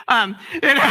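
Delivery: laughing high and loud; giggling aside. Real, not acted. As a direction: cracks up at the story.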